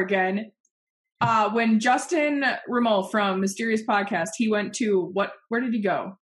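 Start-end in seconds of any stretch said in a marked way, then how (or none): none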